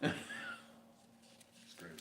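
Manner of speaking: slow breathy whisper with a laugh; whispered echo. Shown as a demonstration. Musing to viewers, a slow whisper. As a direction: repeat it to yourself in a slow whisper.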